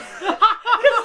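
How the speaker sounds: loud high burst of laughter; disgusted laughter. Real, not acted. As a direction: laugh in grossed-out disbelief. Grisly punchline laugh.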